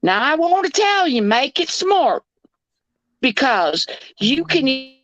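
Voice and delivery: high, sweetly scolding Southern impression; cute Southern lady. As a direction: imitate a Southern belle warning off a burglar. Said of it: "this s-, cute Southern lady comes on".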